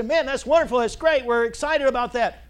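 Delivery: loud, high delighted greeting; excited welcome. Retold or imitated. Pastor replaying how he welcomes a new member, loud and delighted. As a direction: greet the newcomer with a burst of joy.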